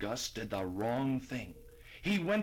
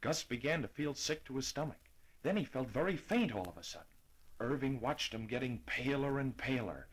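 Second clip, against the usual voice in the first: ordinary delivery, nothing marked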